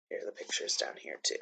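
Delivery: whispered aside to herself; a quick whispered note about the pictures. Real, not acted. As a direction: breathe it under your breath while pointing. Aside while showing the pictures.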